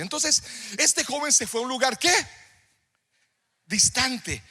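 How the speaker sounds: loud, high-pitched sermon delivery; forceful preaching. Real, not acted. Preacher quizzing the church on Peter's denial, forceful preaching. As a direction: drive each question home to the congregation.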